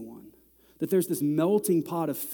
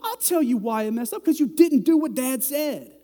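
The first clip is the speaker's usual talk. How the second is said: high, loud fed-up scolding; dad scolding his kids, fed up and loud; 9 semitones higher, 6 dB louder than usual. Retold or imitated. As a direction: let the kids have it. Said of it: "I was like".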